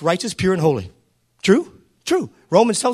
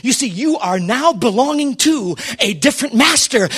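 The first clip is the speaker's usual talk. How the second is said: ordinary talk, nothing marked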